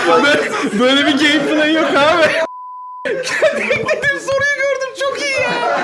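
very high, loud helpless laughter; laughing uncontrollably. Real, not acted. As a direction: talk while cracking up. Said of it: "Ben niye gülüyorum abi?".

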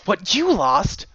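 slow, high, scornful question; spat. A narrator's reading. Daughter throwing father's words back, bitter and slow. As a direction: spit it back in bitter disbelief. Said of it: "Ginger spat".

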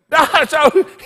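loud high laugh; sudden amused laugh; burst of laughter. Real, not acted. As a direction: break into a laugh. Laughs at the story.

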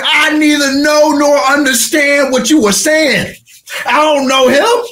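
Peter's desperate denial; cornered denial. Acted. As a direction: deny it in panic. Peter denies Jesus, cornered.

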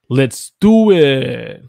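loud, slow let's do it; rally everyone to go; excited cheer. Pretend. Teacher cheering a viewer's phrase.

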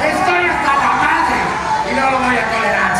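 loud, high defiant chant; rallying shout. Acted. Anchor asking viewers to shout along, rallying shout. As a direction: lead the crowd at full voice. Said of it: "Griten conmigo".

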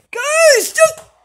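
high, pleading cry. Acted. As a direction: beg them to stop. Kid pleading during prank.

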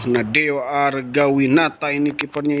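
normal talking voice

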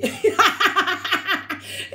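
high laugh; giggle. Real, not acted. At the director's line, a high giggle.

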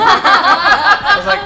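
high, loud laugh breaking in; loud laughter. Real, not acted. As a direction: burst out laughing. Big laugh mid story.

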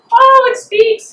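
high, loud, slow gloating crow. Acted. Hecate gloating at the fairy, loud high crow. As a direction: crow it out in triumph. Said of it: "Hecate crowed".

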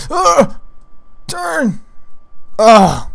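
loud, straining groan of exasperation; frustrated grunt. Real, not acted.

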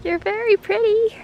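cooing at animals. Real, not acted. Woman coos at farm animals.

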